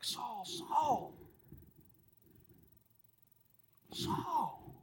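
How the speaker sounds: soft, deeply emotional calling of the name; tender call. Shown as a demonstration. Preacher showing God calling Saul with deep emotion, softly. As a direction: call him gently, full of longing. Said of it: "it's out of deep emotion".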